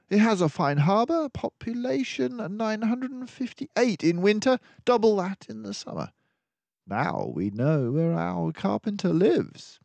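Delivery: detective delighted at finding the town; triumphant discovery. Acted. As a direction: pounce on the answer in the atlas.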